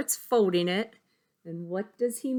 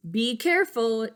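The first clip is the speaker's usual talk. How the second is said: higher, louder warning teacher; Miss Kirby voice; 5 semitones higher, 6 dB louder than usual. Acted. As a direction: call out a caution. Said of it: "she says".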